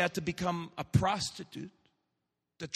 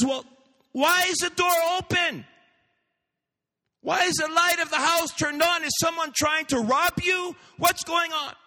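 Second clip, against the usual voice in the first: high, loud panicked questioning; alarmed questions; 12 semitones higher, 4 dB louder than usual. Acted. Alarmed daughter questioning mother.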